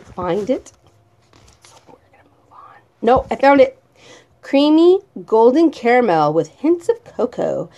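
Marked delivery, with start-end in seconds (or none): 1.6-2.8 whisper. Acted. Whispered aside.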